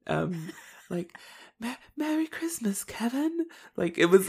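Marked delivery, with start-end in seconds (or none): 1.6-3.5 high, soft, whispery voice; pigeon lady impression. Podcaster mimicking the Home Alone pigeon lady, hushed accent impression. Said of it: "where to place her accent?".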